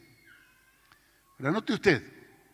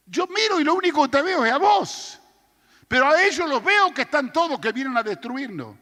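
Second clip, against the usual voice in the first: much higher, louder panicked cry; frantic shout; 9 semitones higher, 7 dB louder than usual. Retold or imitated. Terrified servant shouting to the prophet about the army. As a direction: yell it like the enemy is at the gate.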